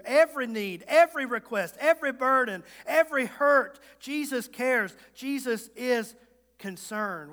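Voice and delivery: loud, rising sermon climb; full-force preaching. Real, not acted. Pastor reminds the weary congregation that Jesus cares, preaching loud. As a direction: preach it out to the back pews.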